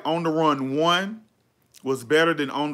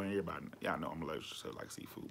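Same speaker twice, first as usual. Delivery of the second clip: hushed aside; 9 semitones lower, 17 dB quieter, much faster than usual. Real, not acted. Aside about her seafood allergy.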